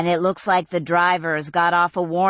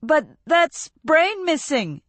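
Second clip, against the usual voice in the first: higher, lightly voiced young man; mild protest; Ren's protest; 11 semitones higher, 3 dB louder than usual. A narrator's reading. Ren voiced in story.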